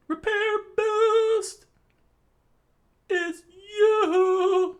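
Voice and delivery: very high, slow and loud; high shrieking attack cry. Acted. Unrepaired launching his attack, high drawn-out shriek. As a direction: wail the attack name out.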